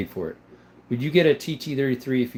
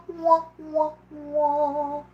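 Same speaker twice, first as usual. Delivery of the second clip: sad trombone; much slower than usual. Pretend. Mock sad trombone after joke.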